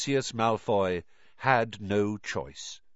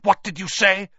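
loud, higher, angry demand; affronted villain, raised voice; spat out; 7 semitones higher, 7 dB louder than usual. A narrator's reading. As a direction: spit it out, raised and sharp. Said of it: "spat Mister Malfoy".

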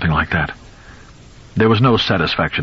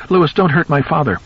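wife screams at husband to stop hitting; screamed plea; 8 semitones higher than usual. Acted. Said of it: "Rachel screamed".

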